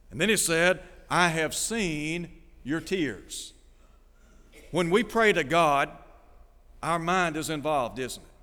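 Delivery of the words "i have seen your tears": very slow, measured words of God; slow, solemn quote. Acted. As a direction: let each word stand as God's answer. Preacher voices God answering Hezekiah.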